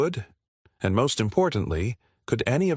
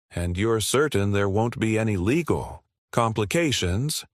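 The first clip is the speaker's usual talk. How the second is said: ordinary delivery, nothing marked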